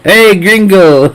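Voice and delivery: a deliberately bad Mexican accent, hammed up; crappy Mexican accent. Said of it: "What a crappy Mexican accent I've got".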